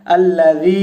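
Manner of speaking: আল্লাযী with the vowel drawn out; lengthened sound. Shown as a demonstration. Teacher shows where to lengthen আল্লাযী. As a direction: stretch আল্লাযী. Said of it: "এখানে টান দিতে হবে".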